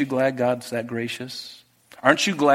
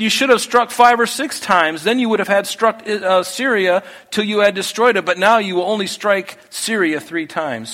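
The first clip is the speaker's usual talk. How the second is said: higher, louder scolding rebuke; angry prophet; 6 semitones higher, 3 dB louder than usual. A narrator's reading. Prophet rebukes the king at three strikes, raised and angry. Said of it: "the man of God was angry with him".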